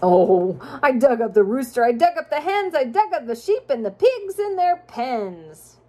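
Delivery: sing-song rhyming storybook read; reading to children. A narrator's reading. Parent reading a rhyming picture book to a child, sing-song. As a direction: lilt the rhymes for a child listener.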